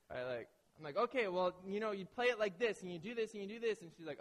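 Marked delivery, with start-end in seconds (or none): 1.0-3.8 higher and louder, rushing through the rules; husband hurrying his fiance through euchre rules, overeager; eager explaining. Retold or imitated. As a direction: push the rules at her, too keen to win. Impatiently explaining the game to fiance.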